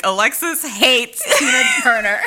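laughing, loud clickbait voice; mock headline shout. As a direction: yell it like a gossip headline. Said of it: "Somebody's gonna chop this up and be like".

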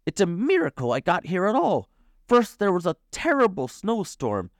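high, animated character voice; high-pitched adventurer's tale; dramatic storytelling uncle. A narrator's reading. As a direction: recount the ordeal with excitement. Uncle dramatically recounts his journey.

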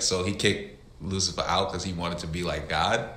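low, soft retold question; questioning kid. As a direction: ask it quietly, puzzling it out. Child questioning preacher, retold.

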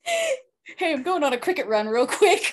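high, chirpy mock call to a roommate, laughing; mock cheerful offer. Teasing cohost with a friend's cricket-run offer, high and laughing. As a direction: sing out the offer like a breezy friend.

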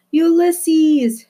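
high, loud calling out his name; shout. Acted. Flora shouts to the squirrel across the restaurant, high and loud. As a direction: call across the noisy room. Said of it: "she shouted".

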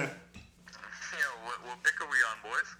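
phone speaker. Real, not acted. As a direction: voice over speakerphone. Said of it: "Let me put you, let me put you on speaker so the people can hear you".

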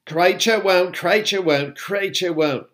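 high, loud stubborn elf croak; croaking house elf. A narrator's reading. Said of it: "croaked the house elf".

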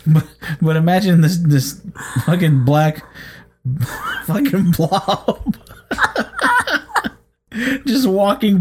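laughing through the words; trying not to laugh, breaking up; stifled giggles. Real, not acted. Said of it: "I couldn't keep a straight face".

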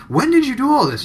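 high, loud startled question; shocked question. Retold or imitated. Friend hears of prison and shootings, shocked question. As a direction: react in disbelief. Shocked reaction in retold talk.

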